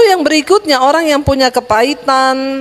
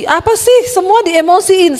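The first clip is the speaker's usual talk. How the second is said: high-pitched, incredulous outburst; mock exasperation; 7 semitones higher than usual. Preacher chides congregation for getting emotional at everything, exasperated. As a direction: voice jumps up in mock disbelief.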